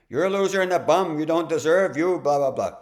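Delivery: high, loud, fast berating voice; inner critic. Acted. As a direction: act out a harsh inner voice hurling insults, pitched well up. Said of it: "parts of us that, uh, say".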